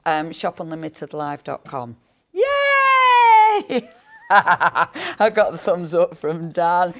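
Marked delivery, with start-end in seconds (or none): 2.3-3.9 high loud yay; excited cheer. Real, not acted. Cheering at thumbs up. 4.0-6.9 laughing. Real, not acted.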